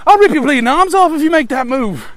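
loud, high-pitched Cockney threat; Cockney yelling Chewbacca. Acted. Chewie threatening his opponent over a chess game, Cockney yelling. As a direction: bellow a threat in a put-on Cockney accent. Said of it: "I want him to be like".

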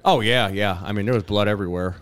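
usual speaking voice

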